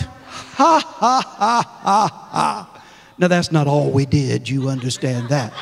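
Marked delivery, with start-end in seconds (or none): 0.0-2.8 deliberate spaced-out ha-ha; acted-out laugh. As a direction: imitate laughing, one ha at a time. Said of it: "We just laughed".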